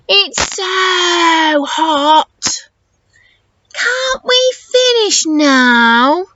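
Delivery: put-on slow whiny groan of a tired boy. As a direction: drag it out like a worn-out child. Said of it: "Albie groans".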